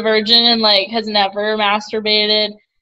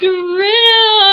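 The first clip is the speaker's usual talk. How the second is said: calling out; 9 semitones higher, 5 dB louder than usual. Real, not acted. Friend joins the call.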